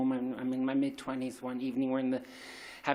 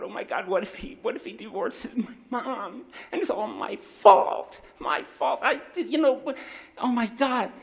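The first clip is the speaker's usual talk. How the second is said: panicked thought; 8 semitones higher, 4 dB louder than usual. Retold or imitated. Panicked thought of parents divorcing.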